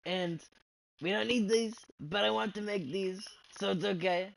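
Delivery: a hint of Trump, pushed up and loud. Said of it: "Talking in this Trump voice".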